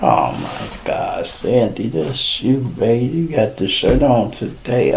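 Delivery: quietly stunned, can't believe it; stunned hush. Retold or imitated. Husband seeing his wife's DMV photo, soft stunned disbelief.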